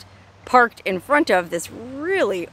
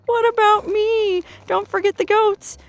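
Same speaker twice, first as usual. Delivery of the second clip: voicing the goat; 12 semitones higher, 4 dB louder than usual. Speaking for the goat craning its neck, high pleading voice. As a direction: plead as the hungry little goat. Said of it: "hopefully, like".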